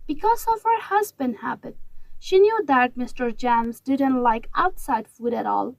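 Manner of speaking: plain reading voice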